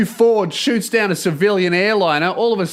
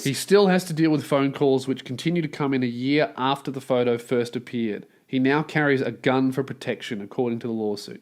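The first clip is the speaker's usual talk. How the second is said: lower, even reading of the report; news article read; 6 semitones lower, 6 dB quieter than usual. A narrator's reading. Host reads the news story to listeners, dry lower read. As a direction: read the lawsuit report straight.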